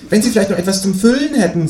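high-pitched kangaroo neighbour; kangaroo character. Acted. Kangaroo asks the new neighbour for eggs, high-voiced. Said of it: "sagt das Känguru".

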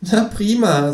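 high kangaroo voice; high-voiced talking kangaroo; kangaroo character. A narrator's reading. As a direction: play the kangaroo, pitched high. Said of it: "sagt das Känguru".

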